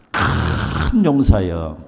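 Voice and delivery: low, very slow, gravelly "큰 용사여"; throaty, phlegmy call. Shown as a demonstration. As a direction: drag it out deep with phlegm in the throat. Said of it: "가래가 좀 끓어야 됩니다. 이럴 때는". (가래 끓는 낮고 아주 느린 목소리)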